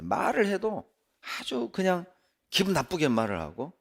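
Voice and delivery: ordinary delivery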